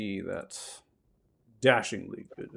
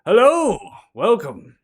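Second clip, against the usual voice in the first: much higher, louder flamboyant bard voice; high theatrical bard; 10 semitones higher, 17 dB louder, slower than usual. Acted. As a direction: play a showy bard, high and loud. Bard greets the party.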